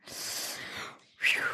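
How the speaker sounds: a soft blown-out whew of relief; airy whistle of breath; breathy whew. Real, not acted. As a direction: exhale the tension away. After stunning episode.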